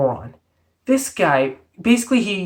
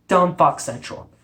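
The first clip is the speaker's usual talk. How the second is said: ordinary talk, nothing marked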